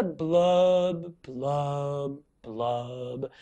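a falling, droopy blub, blub, blub. Shown as a demonstration. Teacher showing kids the falling blub. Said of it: "I love when pout pout fish goes blub".

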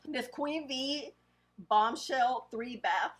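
ordinary delivery

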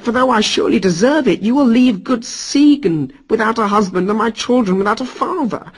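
high pleading whine; wailing Loki. Acted. Loki wails and pleads with Thor for mercy. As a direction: beg for your life, half sobbing. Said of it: "Sobbing like a baby, Loki wailed".

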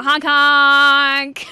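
loud goofy clown honk; silly clown honk. Pretend. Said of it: "I'm a clown now".

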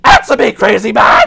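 impatient yell; demanding shout. Real, not acted. Player demands the silent caller answer, impatient shout.

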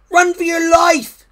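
very high, loud alarm; rabbit's panicked shout. A narrator's reading. Rabbit fleeing the horned thing, shouting in panic. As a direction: shout for everyone to flee. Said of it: "shouted a rabbit".

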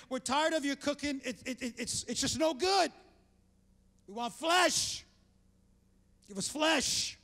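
hammed-up grumbling demand; mock complaint. Israelites complaining to God about the manna, mock griping demand.

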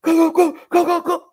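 high loud go go go; frantic urging. Retold or imitated. Juggling a falling hamster, frantic high shouts. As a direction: yell it high while juggling. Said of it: "juggling hamster midair".

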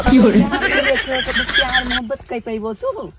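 laughing, mocking question; teasing laugh. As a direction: tease him through laughter. Teasing a lovesick suitor.

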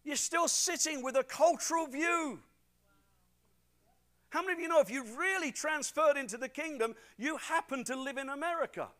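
loud, high preaching voice; full-force preaching. Acted. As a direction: preach it out to the whole church. Preaching to the congregation.

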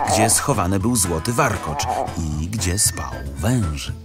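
plain reading voice

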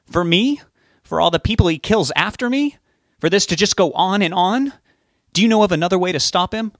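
heated questions, pitched high. A narrator's reading. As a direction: keep firing indignant questions, voice up. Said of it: "Rob's voice was raised as well".

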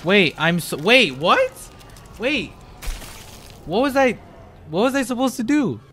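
flustered and lost mid-chase; high bewildered panic; confused panic. Real, not acted.

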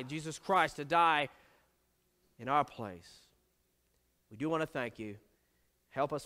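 hushed congregational prayer, low and reverent. Real, not acted. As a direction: pray quietly and earnestly to the Father. Opens the sermon with prayer.

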